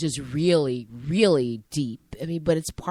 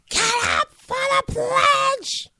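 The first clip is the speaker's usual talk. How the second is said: very high scream, slowly stretched out; drawn-out, high furious yell; screamed order; 3 dB louder, much slower than usual. Retold or imitated. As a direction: scream each word long and loud at a defiant student. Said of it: "she screamed".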